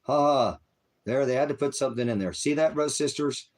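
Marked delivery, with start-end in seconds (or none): 0.0-0.6 mocking laugh. Real, not acted. Ranting streamer mocks chat.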